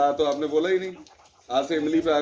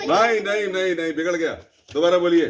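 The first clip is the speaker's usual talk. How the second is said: raised voice cutting off the mistake; loud stop; 3 semitones higher, 5 dB louder than usual. Real, not acted. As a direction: call no, no, and restart them. Teacher halts a wrong recitation. (गलती पर ऊँची आवाज़ में टोकना)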